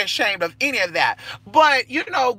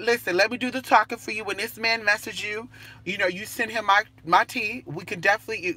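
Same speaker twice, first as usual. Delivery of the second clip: normal talking voice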